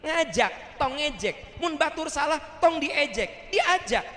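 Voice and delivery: high-pitched put-on elder voice; imitating the teacher. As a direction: hammer out the maxim up high. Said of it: "Ustaz Abdurrahman begitu".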